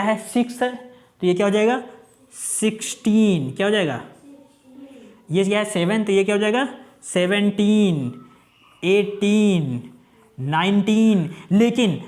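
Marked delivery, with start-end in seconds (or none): 2.3-3.5 drawn-out teaching. Shown as a demonstration. Teacher models number reading. 7.0-9.8 drawn-out teaching. Shown as a demonstration. Teacher models number reading.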